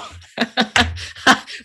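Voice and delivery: loud burst of laughter. Real, not acted. Stumbling over the prayer's name, laughing loudly. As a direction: laugh out loud at your own attempt.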